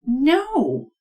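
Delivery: normal talking voice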